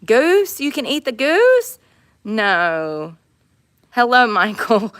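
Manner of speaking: loud greeting to goose; calling out. Real, not acted. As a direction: call out to the bird.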